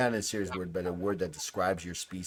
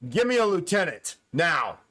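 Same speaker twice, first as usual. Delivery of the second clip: barked command; 7 semitones higher, 4 dB louder than usual. Acted. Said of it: "you can hear this one human sound".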